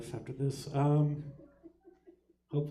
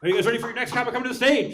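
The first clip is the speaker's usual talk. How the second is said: hype host; 8 semitones higher, 9 dB louder, much faster than usual. Real, not acted. Hyping the crowd.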